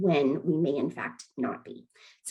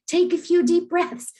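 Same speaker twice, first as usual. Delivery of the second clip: high, loud urging to breathe; 11 semitones higher, 6 dB louder than usual. Retold or imitated. Telling someone upset to breathe, urgently. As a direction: mimic someone pushing advice, louder and much higher.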